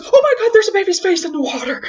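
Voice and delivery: high, loud cry of astonishment; shocked exclamation. Retold or imitated. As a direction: re-enact a stunned dad shouting. Husband's shocked cry, acted.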